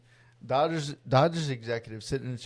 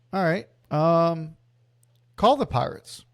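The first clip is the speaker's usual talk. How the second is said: higher and louder boss command; mock executive order; 4 semitones higher, 4 dB louder than usual. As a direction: play the casual boss barking an order. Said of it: "he goes".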